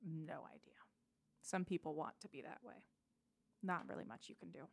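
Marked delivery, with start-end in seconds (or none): 0.0-0.7 breathy whispered reply; whispered brush-off to the worried friend. Acted. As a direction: drop to a bare whisper. Curt answer in character.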